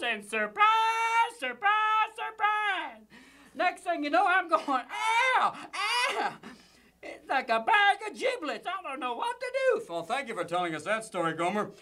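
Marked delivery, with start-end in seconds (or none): 0.0-3.1 drawn-out high Gomer surprise; Gomer's surprise. Acted. 3.5-9.8 Gomer voice. Acted. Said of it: "Gomer, what are you doing here".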